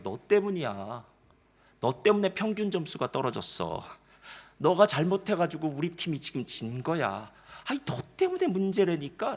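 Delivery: usual speaking voice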